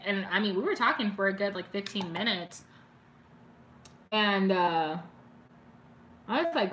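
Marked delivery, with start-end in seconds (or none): none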